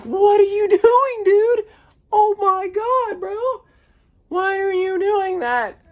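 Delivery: high, loud, cracking voice in disbelief; yelling at a friend, voice breaking high. Real, not acted. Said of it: "voice crack moment".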